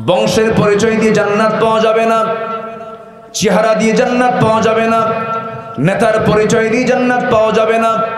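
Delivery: ringing sermon rising to a high shout on Iman; building preaching. Acted. Preacher hammering the point to the crowd, building to a shout. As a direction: drive the crowd toward the word Iman.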